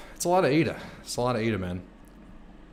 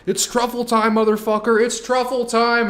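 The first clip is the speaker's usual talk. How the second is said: high, loud hype yell; hollering to get the dog hunting; screaming at the dog; 11 semitones higher, 6 dB louder than usual. Retold or imitated. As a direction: call out like a hype man to rile up the dog. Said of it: "I run down there and I scream".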